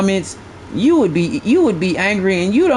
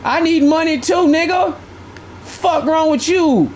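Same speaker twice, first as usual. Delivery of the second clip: higher, slower disbelief; higher-pitched exasperation; incredulous retort; 7 semitones higher, slower than usual. Real, not acted. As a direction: throw it back at him. Retort to mocked viewer.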